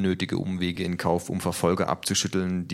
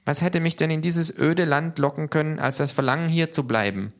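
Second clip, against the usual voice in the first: high character voice, sinking gradually; talking to oneself; 10 semitones higher, 4 dB louder than usual. A narrator's reading. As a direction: wonder aloud as little K. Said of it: "wie im Selbstgespräch". (hohes Selbstgespräch, allmählich sinkend)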